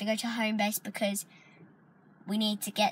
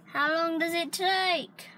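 exasperated; 8 semitones higher, 3 dB louder, slower than usual. Real, not acted. Fed up waiting for mum.

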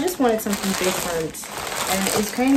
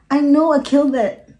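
proud; 6 semitones higher, 8 dB louder than usual. Real, not acted. Proud reveal of finished hair.

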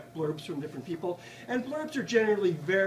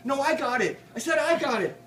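loud and higher, each call climbing over the last; 6 semitones higher, 5 dB louder than usual. A narrator's reading. Bar poets call for the falling ball, loud and rising. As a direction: shout for the ball as it sails toward you. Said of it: "call out".

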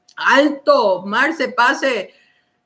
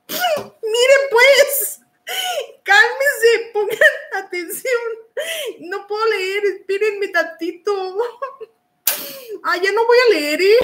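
high, giggly pleading; overcome by laughter; 8 semitones higher than usual. Real, not acted. Host telling chat she cannot read, overcome by laughter. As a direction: beg for calm through helpless laughter. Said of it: "me gana la risa".

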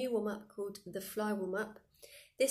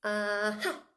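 louder triumphant aha; 4 dB louder than usual. Shown as a demonstration. Teacher demos catching the fly. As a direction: grab it with an aha. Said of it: "really overemphasize everything".

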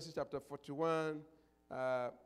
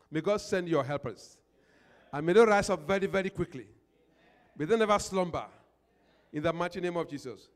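fervent loud prayer; loud preaching; 10 dB louder than usual. Real, not acted. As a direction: proclaim the blessing at full volume. Pastor's loud blessing prayer.

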